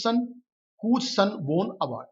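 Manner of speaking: slow and deliberate, word by word; slow dictation reading. Shown as a demonstration. Teacher dictating the whose clause, slowly. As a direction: spell the clause out for the class. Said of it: "teacher reads the joined clause".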